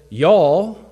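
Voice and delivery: loud, high, drawn-out Southern "y'all"; Southern accent. Acted. Preacher voicing Jesus addressing the crowd, Southern accent. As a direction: hit it with a Southern twang. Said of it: "my southern accent".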